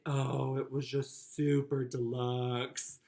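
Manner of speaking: slow, drawn-out mock grandeur; mock over-the-top. Brother answering in great aunt's braggy words, mock-grand. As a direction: stretch it out like the grandest thing ever. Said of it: "use the words deluxe and supreme".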